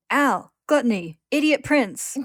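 hollering for his missing companions; shouting again. Acted. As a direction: yell each name across the void. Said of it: "then shouts again".

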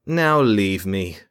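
the mage dismisses his jailers, slow and cold; dismissive mage. A narrator's reading. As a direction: wave them away like servants.

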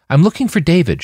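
a hopeful newcomer asking around; Sasha asking. A narrator's reading. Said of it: "she said".

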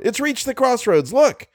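urgent high-pitched call; excited shout. A narrator's reading. Passenger warns the others of the monster, shouting. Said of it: "shouted Algernon".

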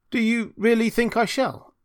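high, hopeful question; hopeful little Hans. A narrator's reading. Hans seeking the miller's assurance, hopeful.

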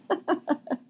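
short soft laugh. Real, not acted. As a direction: let a quiet laugh slip. Laughs at the word futuristic.

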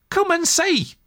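high, gleeful call; delighted. A narrator's reading. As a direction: sing it out with glee. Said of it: "said the delighted rat".

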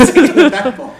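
laughing loudly through the line; giggling as you repeat it. Retold or imitated.

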